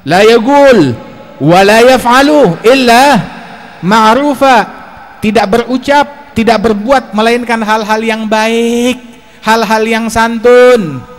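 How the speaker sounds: loud, slow, drawn-out recitation; ringing sermon declamation with long held endings; declamatory preaching. Acted. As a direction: proclaim it to the crowd, stretching each phrase. Preacher declaims quotation and translation.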